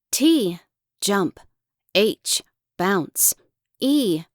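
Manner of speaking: plain reading voice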